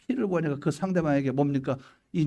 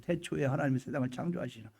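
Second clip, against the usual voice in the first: much softer, reflective recitation; hushed musing; 3 semitones lower, 9 dB quieter than usual. Acted. Believer pondering the creation verse alone, hushed awe. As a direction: murmur the verse to yourself.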